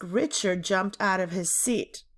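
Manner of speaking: ordinary delivery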